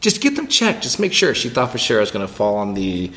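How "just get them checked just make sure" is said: high urging voice of the wife; quoting his wife, pitched up; wife's quote. Retold or imitated. Husband retells wife urging him, mildly voiced. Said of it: "she goes".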